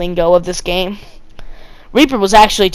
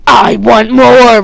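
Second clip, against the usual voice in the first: loud, slow, drawn-out "I want more"; hammed-up, power-mad soldier's cry; bloodthirsty demand; 3 semitones higher, 6 dB louder, much slower than usual. A narrator's reading. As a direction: stretch "want" and "more" greedily, at high volume. Soldier repeats line, turning bloodthirsty.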